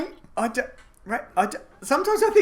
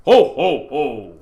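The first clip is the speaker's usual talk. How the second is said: Santa voice; 5 semitones lower, 8 dB louder, much slower than usual. Shown as a demonstration. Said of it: "do your voice".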